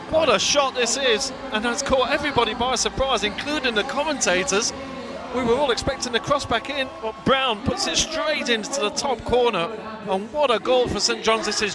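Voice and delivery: thrilled play-by-play on a goal; excited commentary. Real, not acted. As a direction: lift the voice to call a stunning goal.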